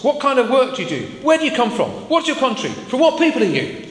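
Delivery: pepper him with questions; agitated sailors. Acted. Sailors interrogating Jonah in story.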